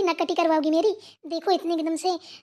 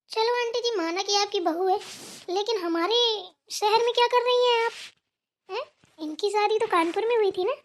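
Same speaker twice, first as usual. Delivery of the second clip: usual speaking voice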